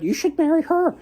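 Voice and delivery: lightly squeaky, high-pitched sly fox. Retold or imitated. Fox telling the woodsman to marry the princess, high sly voice. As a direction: toss it off high like a cheeky little fox.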